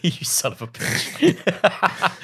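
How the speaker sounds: slow, high, laughing curse; laughing mock insult. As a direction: laugh it out slowly.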